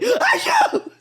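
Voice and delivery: screamed sneeze, very high and loud; huge shouted sneeze. Shown as a demonstration. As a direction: sneeze like a battle cry. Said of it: "I've just resorted to be like".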